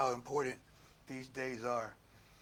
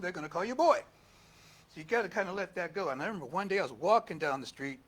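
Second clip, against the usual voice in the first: ordinary talk, nothing marked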